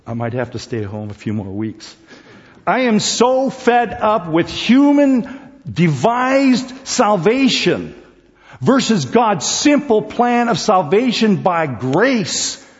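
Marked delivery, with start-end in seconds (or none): 2.7-12.7 slow, loud, high preaching; preaching at full force. Real, not acted. Preacher railing against human-made salvation, slow and loud. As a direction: pound it home like a pulpit climax.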